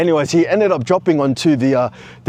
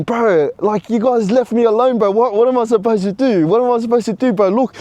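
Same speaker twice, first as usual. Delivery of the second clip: whinging; 6 semitones higher than usual. Retold or imitated. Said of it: "I'm trying to whinge to my brother".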